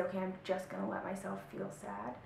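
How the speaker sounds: gentle self-talk. Retold or imitated. Teacher modelling loving self-talk, soft and gentle. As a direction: soothe the inner voice like a friend. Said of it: "And say".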